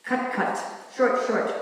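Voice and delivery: "Cut, cut" and "Short, short" as emphatic doubles; punchy demo of doubled "Cut" and "Short"; repeated for emphasis. Shown as a demonstration. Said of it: "is to repeat".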